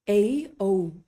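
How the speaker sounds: A and O each with a closing glide; slow, gliding A, O; English diphthongs. Shown as a demonstration. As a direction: let A and O slide into a second vowel. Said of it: "we're actually saying two vowels".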